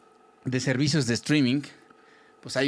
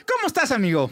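high, put-on cheery greeting; fake friendly; 9 semitones higher than usual. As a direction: sing it out with fake warmth. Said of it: "estuvo muy fingido el "¿cómo estás, amigo?".

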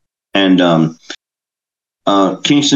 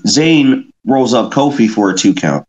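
ordinary talk, nothing marked

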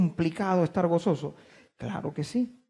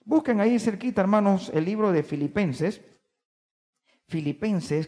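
usual speaking voice

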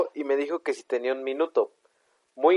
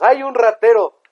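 higher, louder mock shriek; mock yell; 8 semitones higher, 7 dB louder than usual. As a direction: ham up a scared shout.

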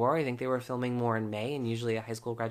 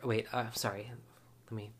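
ordinary delivery

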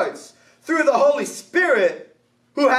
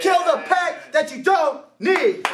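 fired-up preacher shouting the call; preaching at full force; 6 semitones higher than usual. Acted. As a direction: drive the call to repent home. Preaching climax.

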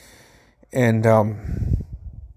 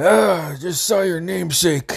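uncle's amused remark; 6 semitones higher than usual. Retold or imitated. Uncle voiced in retold story.

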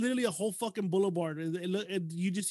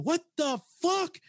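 slow, high-pitched disbelief; imitating a shocked outburst; startled retold exclamation; 10 semitones higher, slower than usual. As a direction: draw out each word in alarm. Retells startled reaction to brother-in-law.

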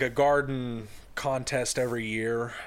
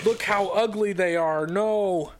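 high, loud, hammed-up whining objection; pleading kid protest; 8 semitones higher, 5 dB louder, slower than usual. Kids see dad's chosen gnomes, mock pleading protest. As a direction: beg dad not to, pitched way up.

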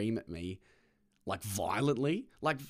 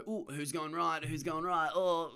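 slightly raised, light sing-along dithering; acted awkward politeness; 5 semitones higher than usual. Two drivers both unsure at an intersection, lightly playful awkward voice. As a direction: dither playfully, as in a friendly mix-up. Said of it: "and you both go".

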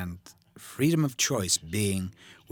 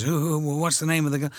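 higher, louder flustered boss; flustered asking; 7 semitones higher, 4 dB louder than usual. Retold or imitated. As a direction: pitch it up like a boss caught out. Stanley panics asking his secretary.

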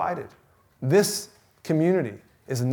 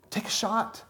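soft urging; 6 semitones higher, 7 dB quieter than usual. Acted. Hushed plea.

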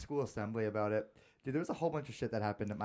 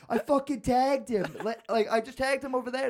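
much higher, loud protest; heated shout; 11 semitones higher, 8 dB louder than usual. Retold or imitated. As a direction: insist at the top of your voice. Kids arguing in a tag game.

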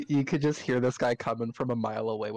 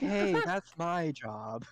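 drawn-out, higher indignant objection; mock protest; 5 semitones higher, much slower than usual. Bard seeing his job taken, drawn-out mock protest. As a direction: object slowly, pitched up, as if robbed.

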